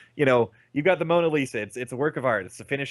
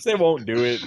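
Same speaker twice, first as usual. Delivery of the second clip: louder, firm and final; emphatic denial; 5 dB louder than usual. Real, not acted. Telling a friend the price won't happen, loud and firm. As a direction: shut the idea down hard.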